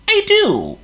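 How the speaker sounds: very high squeaky worm. Acted.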